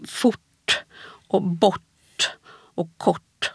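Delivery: "fort", "bort", "kort" with r and t fused into one retroflex sound; spaced-out demo words ending in a retroflex t; supradentals. Shown as a demonstration. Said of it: "alltså det där sammansmälta ljudet".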